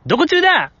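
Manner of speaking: light imitation of a refreshing Sunday-morning anime heroine; fresh sunny girl. Host imitating Kubo's breezy take, high and bright. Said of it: "久保ちゃんの".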